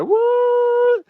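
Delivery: very high, stretched mock shock; shocked squeal. Host reacting to Mama D's timing, high shocked squeal. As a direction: squeal it in disbelief.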